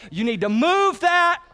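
high, loud, slow, stress on move; preacher driving the point home; emphatic punchline. Shown as a demonstration. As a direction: lean on move like a call to action. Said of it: "preacher's punchline".

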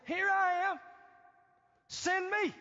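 high, loud, bold declaration; eager volunteering. Acted. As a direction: call out your readiness to the Lord. Acts Isaiah volunteering to God.

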